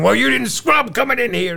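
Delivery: shrill-pitched put-on outrage from the doctor; retold yelling. Neonatologist yelling at the surgeon, high and loud. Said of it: "Starts yelling at Cliff".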